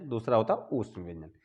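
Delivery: ordinary talk, nothing marked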